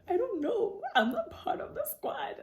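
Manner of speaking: high giggly I don't know; laughing denial. Retold or imitated. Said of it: "This is me".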